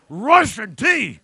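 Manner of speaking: loud, slow, triumphant "Russian tea!"; proud announcement. Retold or imitated. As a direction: present the vodka bottle like a grand surprise. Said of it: "And he said".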